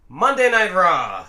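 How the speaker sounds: slow, loud ringside announcer call; announcer intro. Acted. Opening his review, he calls the show title announcer style.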